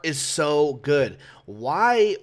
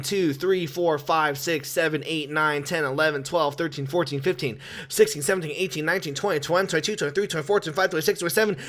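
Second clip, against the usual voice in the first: ordinary talk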